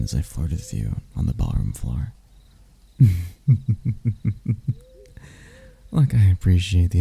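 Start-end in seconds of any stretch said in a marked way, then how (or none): 3.0-4.7 long, rolling chuckle; gloating chuckle. Acted. Demon gloating over a flirted-with hunter, rolling chuckle. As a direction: chuckle on, savoring the win.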